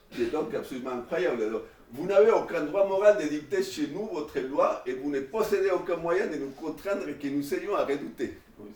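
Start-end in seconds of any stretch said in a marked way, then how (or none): 1.9-8.4 raised, solemn reading voice; higher and louder, proclaiming each clause; declaiming the declaration. Acted. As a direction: read it out like a manifesto to the class. Reads out the declaration aloud.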